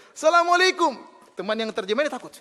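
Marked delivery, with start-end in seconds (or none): 0.1-1.0 loud, high, ringing greeting; shouted greeting. Retold or imitated. Police chief greeting the idol, loud high shout. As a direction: bellow the salam across the temple. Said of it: "saya sengaja keras".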